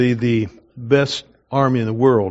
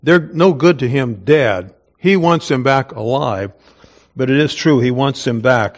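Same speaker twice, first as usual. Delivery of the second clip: normal talking voice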